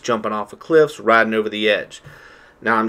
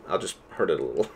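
1 deadpan joke; 6 semitones lower, 8 dB quieter, faster than usual. Acted. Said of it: "I'm just kidding".

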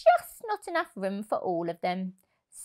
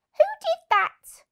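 much higher, louder cross squirrel; 6 dB louder than usual. Acted. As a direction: snap at whoever pushed. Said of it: "asked the squirrel crossly".

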